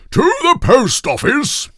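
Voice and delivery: king ordering the trolley, loud and high; commanding king. A narrator's reading. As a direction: command it grandly. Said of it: "commanded King Toothbrush Weasel".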